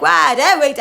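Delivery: startled what. Retold or imitated. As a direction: jump at the news. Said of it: "And I'm like".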